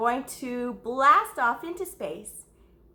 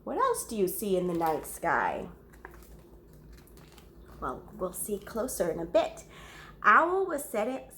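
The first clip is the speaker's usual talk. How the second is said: ordinary delivery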